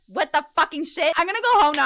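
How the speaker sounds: mock freaked-out outburst, voice climbing; freaked-out disbelief. Woman fleeing the laughing kids, freaked-out disbelief.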